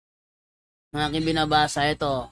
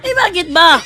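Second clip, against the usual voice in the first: high, loud, laughing "bakit ba"; hammed-up defensive reply; defensive retort; 9 semitones higher, 9 dB louder than usual. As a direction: snap back in mock annoyance. Atheist gets defensive.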